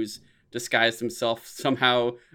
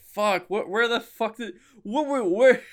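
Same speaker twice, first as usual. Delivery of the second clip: high, flustered sputtering; high, confused disbelief; bewildered; 10 semitones higher, 3 dB louder than usual. Retold or imitated. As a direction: sputter it, lost for words. Bewildered leaving the theater.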